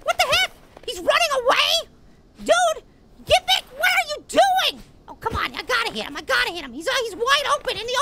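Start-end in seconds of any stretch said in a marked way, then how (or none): 0.0-4.8 higher, indignant outburst; exasperated disbelief. Real, not acted. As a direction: call after a fleeing opponent in outrage. Opponent escapes, exasperated protest.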